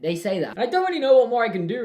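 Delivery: ordinary talk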